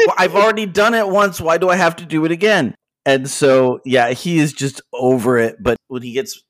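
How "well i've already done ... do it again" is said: higher, faster exasperated complaint; exasperated whine. Retold or imitated. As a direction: whine it like you're fed up. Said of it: "And he's like".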